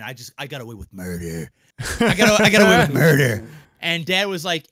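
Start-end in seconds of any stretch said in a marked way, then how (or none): 0.0-1.5 hushed gloat. Retold or imitated. Quietly gloating over the report card. 2.0-3.5 laughing gloat. Retold or imitated. Gloating over the report card.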